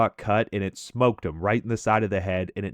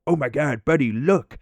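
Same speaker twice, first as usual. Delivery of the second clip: mock excited; 5 semitones higher, 4 dB louder than usual. Imitating Mike's excited discovery.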